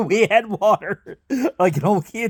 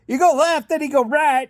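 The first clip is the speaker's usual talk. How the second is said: mock direction-giver; 6 semitones higher than usual. Imitating people giving directions, high.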